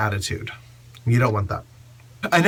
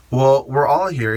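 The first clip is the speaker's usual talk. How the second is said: ordinary talk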